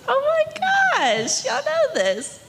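very high, slow, amazed gasp of joy; stunned that the kids know the song; delighted surprise. Retold or imitated. Recalling kids singing along with her, very high and amazed.

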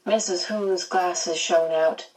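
ordinary delivery, nothing marked

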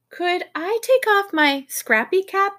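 high, slow pleading question; child character asking. A narrator's reading. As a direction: ask high and drawn out, as Lindy would. Voicing little Lindy's request.

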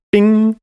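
high electronic "bing"; high, ringing mock device chime; imitated speaker chime. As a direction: sing out a smart-speaker alert tone. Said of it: "my speaker triggers and it goes".

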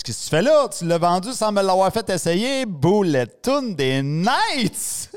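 high, outraged protest; indignation rejouée. Retold or imitated. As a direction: act out the shocked son. Son reproche au père. (indigné, voix aiguë et véhémente)

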